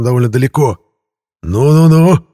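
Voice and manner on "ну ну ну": high, loud gloating shout; gloating yell. A narrator's reading. The ghost gloats loudly at the keeper. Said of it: "злорадно проорал призрак".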